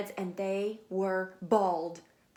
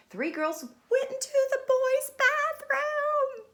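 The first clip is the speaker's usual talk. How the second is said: high, loud, slow gasp of shock; high shocked delivery; scandalized punchline; 7 dB louder, slower than usual. A narrator's reading. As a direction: land the joke with mock horror. Funny punchline.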